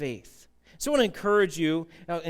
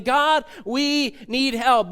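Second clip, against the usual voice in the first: desperate cry; 5 semitones higher, 4 dB louder, much slower than usual. Acted. Israelites crying out to God.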